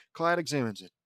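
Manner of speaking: usual speaking voice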